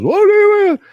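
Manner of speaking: very high squeaky feigned confusion; mock innocent squeak. Mocking those who play dumb, squeaky and high.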